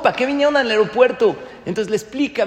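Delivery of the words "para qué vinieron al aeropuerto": surprised pilot. Retold or imitated.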